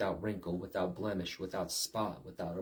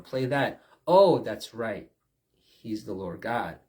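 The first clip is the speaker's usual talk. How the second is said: ordinary delivery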